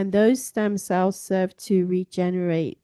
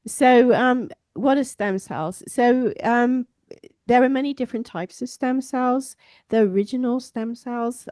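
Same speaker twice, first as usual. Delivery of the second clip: raised voice for the back row; loud projecting voice; 4 semitones higher, 7 dB louder than usual. Real, not acted. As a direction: project loudly to a hall. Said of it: "I'll just yell".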